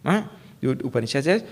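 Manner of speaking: usual speaking voice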